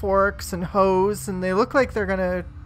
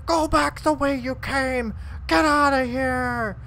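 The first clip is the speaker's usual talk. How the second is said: angry yell; 6 semitones higher than usual. Acted. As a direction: warn them off, hostile. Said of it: "one of them's yelling".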